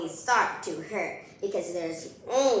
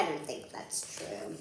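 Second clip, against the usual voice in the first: whisper; 4 semitones lower, 10 dB quieter than usual. Real, not acted. Quiet aside answering own question.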